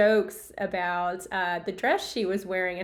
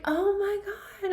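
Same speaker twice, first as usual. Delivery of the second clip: much higher, slow gasp of delight; excited surprise; 12 semitones higher, slower than usual. Retold or imitated. Fan hears the mom's Grammy news, high slow delight. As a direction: gush with amazement. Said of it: "And I'm like".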